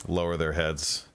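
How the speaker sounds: ordinary talk, nothing marked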